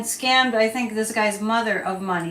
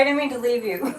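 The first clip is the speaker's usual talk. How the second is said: ordinary delivery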